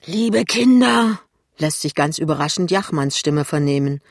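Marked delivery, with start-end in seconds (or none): 0.1-1.2 put-on slow, high, loud drunken greeting; boozy sing-out to the room; tipsy. A narrator's reading. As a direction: draw it out like a merry drunk. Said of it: "dass der Besitzer beschickert ist".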